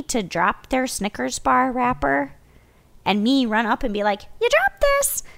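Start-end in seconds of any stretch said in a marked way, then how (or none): 4.4-5.2 very high, put-on friendly voice; mock-helpful singsong to a litterer; cheery call-out.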